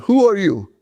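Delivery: high, affronted demand in a mock accent; huffy outraged question; a hint of a huffy foreign diplomat; indignant.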